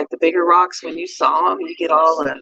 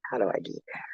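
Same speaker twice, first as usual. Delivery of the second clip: whisper; 5 semitones lower, 13 dB quieter, faster than usual. Retold or imitated. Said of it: "you're like".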